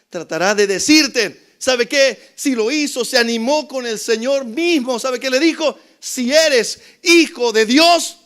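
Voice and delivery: loud, high-pitched sermon storytelling; fiery preaching. Acted. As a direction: preach it at full force to the congregation. Preaching at full force.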